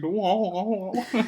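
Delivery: high garbled wah wah; imitating a voice drowning in lag. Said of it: "He was like".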